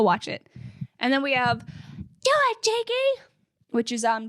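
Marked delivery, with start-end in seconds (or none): 1.6-3.3 very high mock yell at no great volume; Josh. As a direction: imitate a bandmate yelling encouragement, way up high. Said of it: "Josh yelling at Jake to do it".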